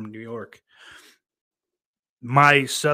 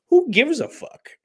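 exasperated; 8 dB louder than usual. Real, not acted. Exasperated outburst about critics.

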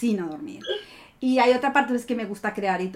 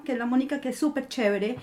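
usual speaking voice

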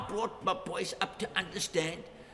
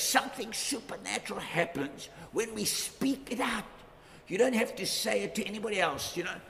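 ordinary talk

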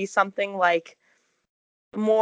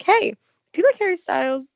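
teasing question; 7 semitones higher, 5 dB louder than usual. Retold or imitated. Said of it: "We're like".

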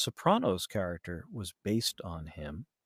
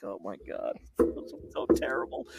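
cringing aside; 5 semitones lower, 7 dB quieter than usual. Retold or imitated. Listener's reaction to bad parody.

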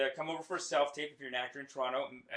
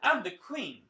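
higher, louder Southern preacher; Southern accent; 6 semitones higher, 5 dB louder, much slower than usual. Retold or imitated. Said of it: "He's like, this Southern accent".

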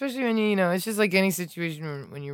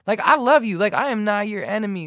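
higher, louder impassioned plea; retold yelling; 6 semitones higher, 4 dB louder than usual. Cyclist yells love at hostile driver, retold impassioned shout. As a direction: re-enact yelling an appeal at someone hostile. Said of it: "I started yelling at him".

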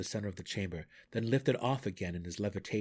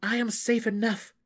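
firm interjection; 12 semitones higher, 5 dB louder than usual. A narrator's reading. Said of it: "Drizzt interjected".

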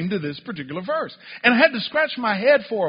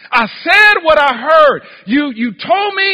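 loud, high, sustained impassioned shouting; preaching at full force; 9 semitones higher, 11 dB louder than usual. Acted. Preacher acts out the prophet pleading his case to God, preaching forcefully. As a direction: pour out the prophet's protest at full volume.

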